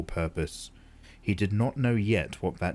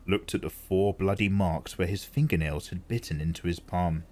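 plain reading voice

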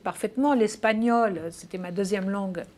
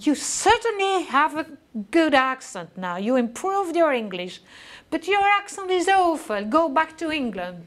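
high, loud mock scolding in English; hammed-up imitation of an appalled professor, pitched high; dismayed study director; 6 semitones higher, 6 dB louder than usual. Study director judging the returning student's English accent, mock-appalled. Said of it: "aux grandes dames de mon directeur d'études".

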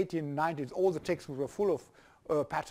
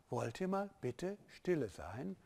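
asking for quiet; 12 dB quieter than usual. Shown as a demonstration. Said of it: "teacher asks class for quiet".

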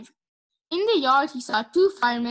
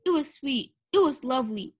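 ordinary delivery

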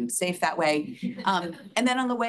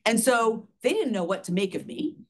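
normal talking voice

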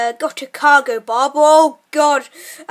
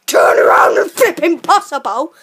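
sat nav voice yelling the prompt, louder; a GPS losing it and shouting; shouted sat nav; 7 dB louder than usual. Pretend. Bossing his friend as a sat nav, loud mock shout. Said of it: "I'll be your sat nav".

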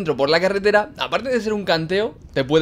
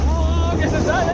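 alarmed; 13 semitones higher than usual. Real, not acted. Water about to spill.

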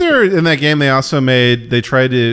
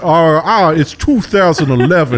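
hammed-up antique Southern accent, higher; old Southern belle accent; 6 semitones higher, 4 dB louder than usual. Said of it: "like, an old Southern belle".

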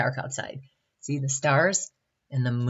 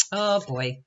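usual speaking voice

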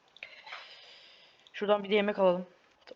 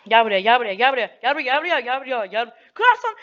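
loud high taunting calls; shouting; 4 semitones higher, 8 dB louder than usual. Real, not acted. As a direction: shout come here at the enemy. Fighting an enemy player. (bağırarak meydan okumak)

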